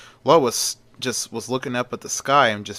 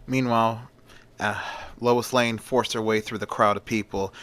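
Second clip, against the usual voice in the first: usual speaking voice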